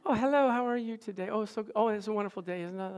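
high, softening singsong mimicry; too-sweet Pollyanna. Second hygienist greeting a patient, Pollyanna sweetness. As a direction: imitate someone sickly sweet and cheerful. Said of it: "she's like a Pollyanna".

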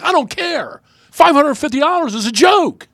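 high, loud mock exasperation; indignant retort. Host replaying his retort to Bruce, high-pitched indignation. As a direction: brush off the excuse in a high-pitched protest. Retold argument, dismissing the excuse.

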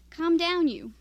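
sharp but good-humored hush to a puppy; firm calming command. A narrator's reading. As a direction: tell the dog to calm down, with authority. Man calming a yapping dog.

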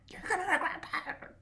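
villain voice. Retold or imitated.

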